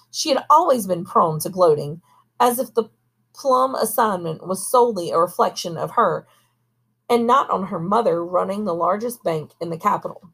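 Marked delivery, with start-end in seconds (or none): none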